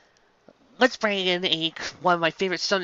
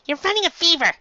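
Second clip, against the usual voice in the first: much higher, squeaky small doctor; high little Chopper; 13 semitones higher, 5 dB louder than usual. Acted. Chopper answering Sanji about Luffy, high squeaky voice. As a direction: squeak it like a tiny reindeer.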